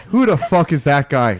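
loud, lilting attempt at the coach's line; sing-songy imitation. Shown as a demonstration. Trying to copy the coach's line, sing-songy and loud. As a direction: copy the phrase with a loud, sing-song lilt. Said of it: "I do a sing-songy dance thing with my voice".

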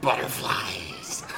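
low, slow Batman growl. Pretend. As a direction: growl it slowly. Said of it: "can you say butterflies again?".

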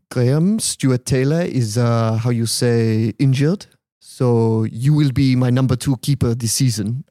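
put-on slow, halting French-accented English; measured mock French accent; Arsene Wenger. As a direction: imitate a French manager searching for words. Manager Wenger acted, halting English.

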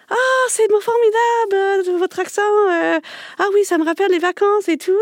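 voix très haute, lente, extasiée; extase exagérée. Retold or imitated. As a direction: imiter une postdoc parisienne qui s'extasie en minaudant. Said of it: "elle fait". (la postdoc parisienne s'extasie sur l'accent, voix très haute et ravie)

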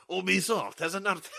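a mock French news read that breaks into a laugh; French accent. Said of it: "Nate's gonna read it in a French accent".

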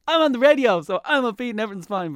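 high, loud, hammed-up cheer; sarcastic chirpy enthusiasm; mock upbeat. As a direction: overdo the sunny radio persona. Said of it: "super happy now".

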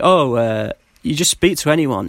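ordinary delivery

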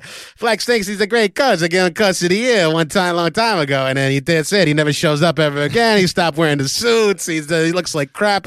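mocking the coach as Dave; imitating the father-in-law ribbing the groom; Dave impression. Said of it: "That's my best Dave".

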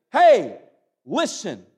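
loud hey, then listen and look; crying out. Acted. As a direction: call out like the Lord summoning. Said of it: "And he is crying out".

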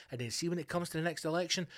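usual speaking voice

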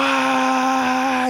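high scream. Retold or imitated. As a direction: scream all the way down the drop. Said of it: "Jag skriker hela vägen ner".

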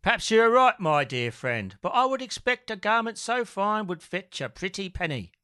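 high Michael Caine put-on; sly thief talking money, Caine style; Michael Caine impression. Jackie persuades Bird, in a Michael Caine voice. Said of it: "He does sound a lot like him".

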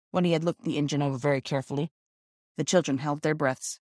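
ordinary delivery

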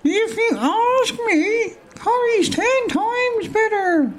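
very high and very slow; very high, slow know-it-all hologram; fourth hologram. Acted. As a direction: stretch it out in a squeak. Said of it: "says a fourth".

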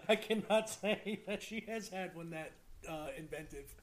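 laughing through the words; amused, words broken by laughter. Real, not acted. Laughing at the soup tubes idea.

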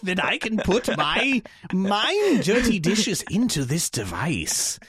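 put-on theatrical old-timey ghost voice; hammy stage actor. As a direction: ham it up like a Shakespearean actor. Ghost voiced in old-fashioned speech.

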